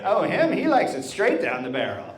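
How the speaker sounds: hammed-up knowing gossip, pitch swooping high; old-timer gossip.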